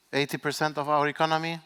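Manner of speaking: ordinary delivery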